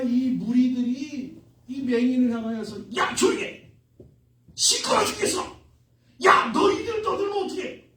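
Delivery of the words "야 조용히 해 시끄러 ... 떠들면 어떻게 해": high-pitched, irritated mock shouting; acted scolding crowd. Crowd hushing noisy blind men, acted angry scolding. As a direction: tell them off in bursts, as an annoyed mob. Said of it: "무리가 꾸짖어 잠잠하라 하되".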